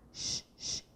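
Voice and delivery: voiceless sh, sh; sh sound. Shown as a demonstration. Teacher linking the sh in chuva and cachorro, soft voiceless sh. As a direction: hush a soft sh twice. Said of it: "esse som de sh".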